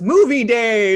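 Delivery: loud cheerful announcement; excited teacher. Retold or imitated. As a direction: call it out like good news. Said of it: "the teacher says".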